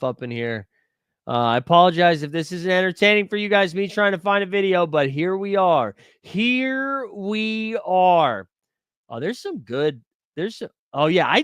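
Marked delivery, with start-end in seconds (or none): 6.2-8.5 very slow, higher, stretched-out words; comically drawn out. Pretend. Streamer apologizing for a dull video hunt, comically drawn out. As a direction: stretch every word out long.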